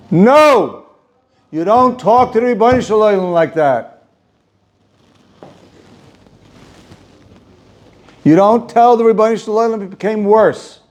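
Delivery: stern rebuke. Retold or imitated. God rebukes Moshe, retold.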